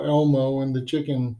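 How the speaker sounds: ordinary talk, nothing marked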